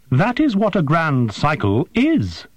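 high creature voice, explaining the obvious; patiently explaining the obvious. A narrator's reading. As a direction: talk down as if to a hatchling. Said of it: "explaining something obvious to someone just hatched".

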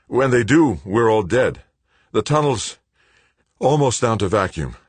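urgent words between breaths; ragged breathing. A narrator's reading. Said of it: "breathing raggedly".

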